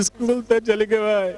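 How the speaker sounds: put-on high, slow, wailing child; crying child voice. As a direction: whine it out slowly like a sobbing boy. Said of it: "तो वो क्या कहता है".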